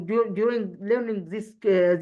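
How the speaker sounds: usual speaking voice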